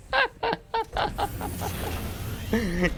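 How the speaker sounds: high, long staccato cackle; drawn-out string of high ha-has; staccato laugh. Real, not acted. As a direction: laugh in quick high pulses. Laughs at own salt joke.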